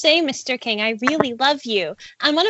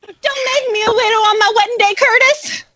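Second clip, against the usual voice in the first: higher, louder, impassioned plea; with passion; 8 semitones higher, 5 dB louder than usual. Shown as a demonstration. Actress reading her one line for King, higher and louder with passion. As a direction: beg him with full passion. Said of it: "With passion".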